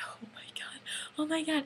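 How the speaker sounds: hushed, whispered disbelief; awestruck whisper. Retold or imitated. Fan waiting her turn to meet the band, awestruck whisper. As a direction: whisper it as if stunned.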